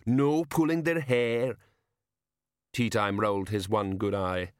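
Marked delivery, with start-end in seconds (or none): 0.1-1.6 slow, higher, lumbering voice; big simple man reciting mother's rule; rumbling giant. A narrator's reading. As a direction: drag each word out doggedly. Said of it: "he rumbled".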